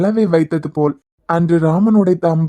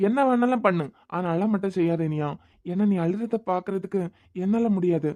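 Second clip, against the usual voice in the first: softer, begging her to stop crying; soft pleading; 8 dB quieter than usual. Acted. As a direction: drop almost to a hush as you plead. Begs crying girl to stop.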